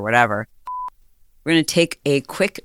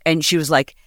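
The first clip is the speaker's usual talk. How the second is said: ordinary delivery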